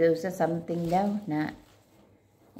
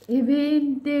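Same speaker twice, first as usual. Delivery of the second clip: put-on child's wail; 7 semitones higher, 6 dB louder than usual. Child crying, quoted by uncle.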